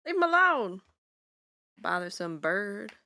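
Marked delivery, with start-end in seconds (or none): 0.0-0.8 scolding shout. Real, not acted. Scolding bird again.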